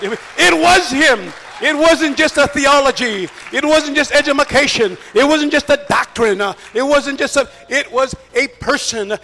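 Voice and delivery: loud, high, ringing declarations; full-force preaching. Real, not acted. Preacher testifying to the crowd it was Jesus, full-force preaching. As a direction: proclaim each phrase at full force.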